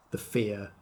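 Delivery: ordinary talk, nothing marked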